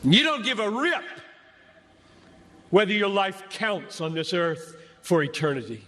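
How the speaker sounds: ordinary talk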